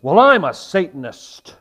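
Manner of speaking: loud, confrontational announcement, imitated; in-your-face. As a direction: blurt it out to grab attention. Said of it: "Just like that. So in my face".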